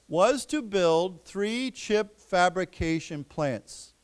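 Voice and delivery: slow, measured reading with long pauses; careful classroom reading pace; slow read-aloud. Shown as a demonstration. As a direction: read it phrase by phrase for learners to follow.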